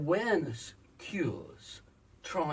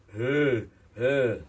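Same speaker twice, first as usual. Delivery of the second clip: much louder full-chest "Hey, hey"; 12 dB louder than usual. Shown as a demonstration. Coach showing Mark chest voice, loud. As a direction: punch it out from the chest. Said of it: "this is chest voice".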